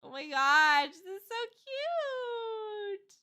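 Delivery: drawn-out squeal of delight; excited gushing. Real, not acted. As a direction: gush over something adorable, stretching it out high. Gushing over cute fan art.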